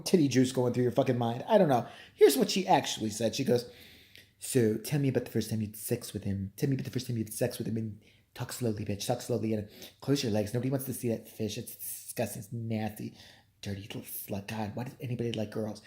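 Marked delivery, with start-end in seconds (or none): 4.4-15.8 low, soft voice sinking towards a whisper; hushed interviewer impression. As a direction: play the interviewer turning disgusted under the breath. Voices the interviewer muttering insults.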